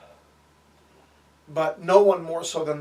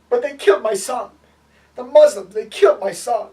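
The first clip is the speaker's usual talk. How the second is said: high, loud protest of a bereaved father; retold grief-stricken outcry; 7 semitones higher, 9 dB louder than usual. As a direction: cry out in acted grief and accusation. Retold man cries over killed son.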